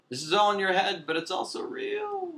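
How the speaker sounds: high, airy, cryptic wisdom; mystical guide. Retold or imitated. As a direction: sound like a mystic oracle. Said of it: "Neo and the architect thing".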